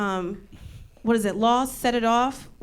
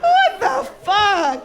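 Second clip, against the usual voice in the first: high, drawn-out exclamation; loud incredulous cry, each word stretched; mock outrage; 7 semitones higher, 4 dB louder, slower than usual. Comic appalled nobody corrected her, outraged.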